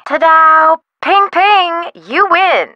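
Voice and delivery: high, showy game-win announcement; theatrical, high-pitched congratulations; mock prize fanfare. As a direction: ring it out high like a winner's bell. Mock prize fanfare on answering phone.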